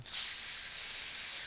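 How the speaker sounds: long, slow hissing s sound; drawn-out s like a snake. Real, not acted. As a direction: stretch the s into a snake hiss. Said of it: "didn't mean to sound like a snake".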